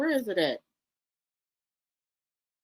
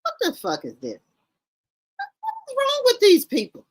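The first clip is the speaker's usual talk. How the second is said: real appalled disbelief; 12 semitones higher, 4 dB louder than usual. Real, not acted. Appalled reaction to the outfits.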